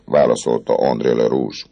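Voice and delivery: plain reading voice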